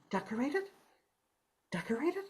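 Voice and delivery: soft, questioning repeat with a rising end; baffled echo. A narrator's reading. Moomins left alone wondering about decorating a tree, baffled. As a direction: puzzle over the word quietly.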